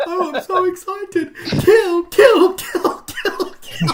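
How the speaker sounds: very high, loud, giggly creepy chant; Film Brain voice. Retold or imitated. Voice in Fifi's head, high gleeful chant of kill. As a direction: squeal with glee, then chant kill. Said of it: "I gotta do this in the fucking voice".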